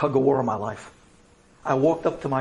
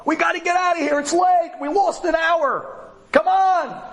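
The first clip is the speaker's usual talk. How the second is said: high, strained impatient yelling; screaming friends; 12 semitones higher, 3 dB louder than usual. Retold or imitated. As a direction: call out urgently as the friends, pitch way up. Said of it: "My friends were screaming, calling".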